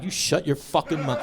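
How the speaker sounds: low, soft menacing mutter; held-back inner threat, quiet. Retold or imitated. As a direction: mutter it under your breath. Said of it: "I didn't say it".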